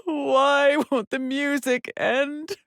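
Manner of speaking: high, slow, tearful wail; anguished crying. Acted. Tormented woman begging the music to end, crying. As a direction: break down pleading, voice drawn out. Breaking down over endless music.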